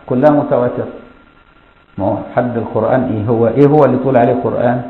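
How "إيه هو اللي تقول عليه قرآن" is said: ordinary talk, nothing marked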